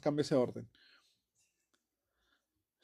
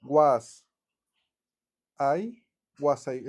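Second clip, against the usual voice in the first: "Was" then "I" slowly, pulled apart; the choppy way, with a gap after "Was"; slow separated words; 5 dB louder, much slower than usual. Shown as a demonstration. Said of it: "Mucha gente dice así".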